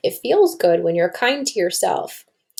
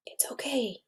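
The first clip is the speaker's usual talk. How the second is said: soft whispered self-comfort; whispered thought; 3 semitones higher, 14 dB quieter than usual. Acted. Cody calming himself on stage, whispered inner thought. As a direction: whisper it to yourself to calm down. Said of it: "he thought".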